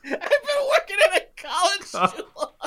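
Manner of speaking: high, breaking with laughter; laughing through words. Real, not acted. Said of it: "laughing at partner's line".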